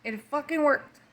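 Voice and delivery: tearful disbelief at relief. Real, not acted. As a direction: let the relief break through the tears. Repeats with tears of relief.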